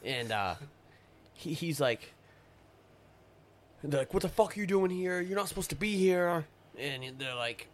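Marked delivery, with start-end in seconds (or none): 4.1-6.4 imitating a soldier's raised, fast demand, higher pitch; mock confrontation, pushed up and quick; retold angry challenge. Podcast guest retells soldiers yelling at his crew, mock angry demand.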